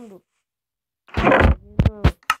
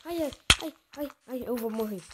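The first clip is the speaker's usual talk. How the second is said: panicked; 5 semitones higher, 11 dB louder, faster than usual. Real, not acted. Health dropping in game.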